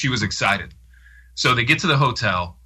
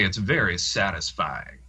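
slow, low Southern preacher-like delivery; creepy Southern-accent imitation; 4 semitones lower, 4 dB quieter, slower than usual. Friend imitates the taped speaker discussing sex, creepy slow Southern accent. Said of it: "he would say it in that kinda, like".

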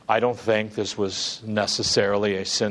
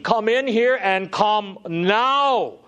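mock child scream; 11 semitones higher, 4 dB louder, slower than usual. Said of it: "They're screaming".

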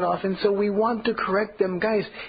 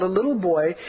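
ordinary talk, nothing marked

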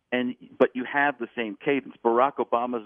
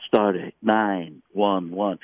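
slow halting Shatner cadence; William Shatner; 3 semitones lower, much slower than usual. Retold or imitated. Showing Shatner's cadence, slow and halting. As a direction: drag it out with Shatner pauses. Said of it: "William Shatner".

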